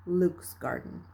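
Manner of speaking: usual speaking voice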